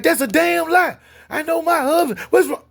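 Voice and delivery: high, loud outraged voice; indignant wife. Retold or imitated. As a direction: imitate a wife refusing to buy it, shrill with alarm. Acts wife angrily rejecting the excuse.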